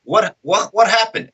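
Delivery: high, puzzled questioning; imitated dazed confusion. Concussed fighter asking friends again, imitated high confusion.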